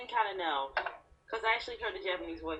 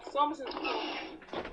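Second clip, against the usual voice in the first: slow drawn-out; 7 semitones higher, 4 dB quieter, much slower than usual. Real, not acted.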